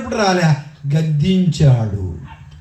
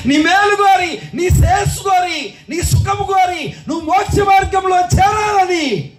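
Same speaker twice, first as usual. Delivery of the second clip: preaching at full force; 13 semitones higher than usual. Acted. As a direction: proclaim it to the congregation.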